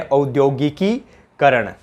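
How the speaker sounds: औद्योगिकीकरण said slowly, syllable by syllable, au-dyo-gi-ki-ka-ran; slow careful pronunciation. Shown as a demonstration. Teacher to students, slowly spelling out the correct word. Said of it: "शुद्ध उच्चारण क्या होता है".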